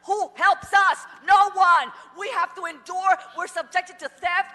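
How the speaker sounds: real fury, yell it at them; furious shout at the council; angry shout. Real, not acted. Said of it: "Because I'm pissed".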